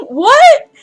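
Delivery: loud, high, stretched-out disbelief; real baffled yelling at the script; incredulous outburst. Real, not acted. Reader can't follow the character's argument, incredulous outburst.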